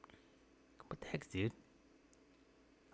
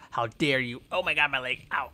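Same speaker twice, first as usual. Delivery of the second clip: usual speaking voice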